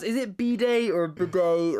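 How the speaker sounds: first "bidet" as BEE-day, second "bidet" as buh-DAY; two pronunciations compared. Shown as a demonstration. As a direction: contrast "bidet" with a long ee against "bidet" with a weak buh. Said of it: "How do you pronounce".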